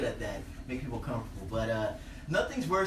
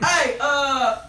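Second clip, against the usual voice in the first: high, loud holler; yell; 12 semitones higher, 8 dB louder, slower than usual. Retold or imitated. Comic yelling to a horse rider from his car, loud holler. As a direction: shout the question out the car window. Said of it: "do my traditional yell".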